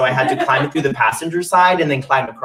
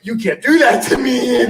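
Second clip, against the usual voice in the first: mock plea; 10 semitones higher, 5 dB louder than usual. Pleading with broken van.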